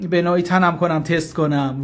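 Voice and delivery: normal talking voice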